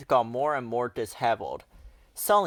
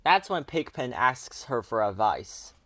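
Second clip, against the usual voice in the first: usual speaking voice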